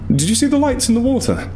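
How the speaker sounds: high, urgent, alarmed asking; alarmed question. A narrator's reading. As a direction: ask it wide-eyed and urgent. Narrator panics to steward.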